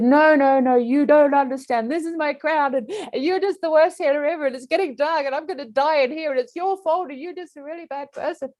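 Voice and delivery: drowning person rages at the healer; tantrum. Acted. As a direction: wail and blame like a furious child.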